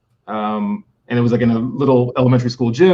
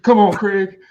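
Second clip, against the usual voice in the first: mock pleading; 8 semitones higher, 3 dB louder, slower than usual. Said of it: "Iguodala chiming in with".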